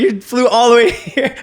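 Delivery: high, loud, laughing through it; marvel, laughing; amazed laughing. Retold or imitated. Amazed he flew to him.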